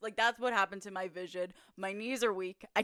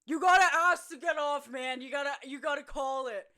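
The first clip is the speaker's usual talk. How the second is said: raised, loud start, easing off; mock shouting; 5 semitones higher, 5 dB louder than usual. Teammate telling hurt player the rule, shouted. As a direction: call instructions across a field.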